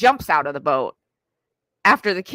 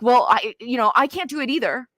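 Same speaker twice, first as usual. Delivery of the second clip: higher, mildly frazzled parent giving up; overwhelmed parent; 6 semitones higher than usual. Retold or imitated. Parent telling the kid they can't either, higher and frazzled. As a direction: play the parent at the end of their rope, lightly.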